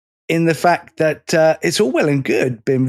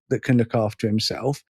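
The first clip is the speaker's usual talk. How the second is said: ordinary talk, nothing marked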